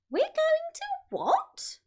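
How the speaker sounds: high, whispered, slow disbelief; astonished cry. A narrator's reading. The little monkeys react to their dad's plan, high astonished whisper. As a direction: gasp it out in amazement. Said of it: "they cried".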